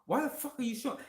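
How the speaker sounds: high, disbelieving what?; retold shocked reaction, voice jumping up; incredulous outburst. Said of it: "I'm like".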